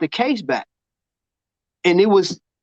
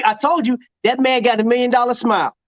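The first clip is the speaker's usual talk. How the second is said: normal talking voice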